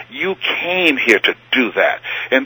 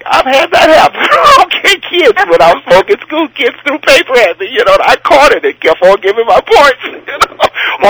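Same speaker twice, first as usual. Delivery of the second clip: laughing; 13 semitones higher, 5 dB louder than usual. Real, not acted. Laughing about being heckled.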